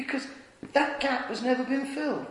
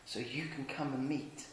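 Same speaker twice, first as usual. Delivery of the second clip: hushed whisper; 8 semitones lower, 15 dB quieter than usual. Acted. Jesus on the cross forgiving us, whispered softly. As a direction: breathe it like words from the cross.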